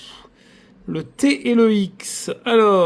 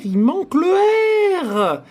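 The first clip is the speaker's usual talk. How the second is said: exasperated cry; 10 semitones higher, slower than usual. Real, not acted. Scratching a ticket, he wails to viewers about the missing R.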